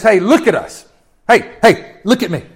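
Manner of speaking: calling out, hey, look at me; Peter's loud call to the beggar; bold command. Acted. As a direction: grab his attention boldly.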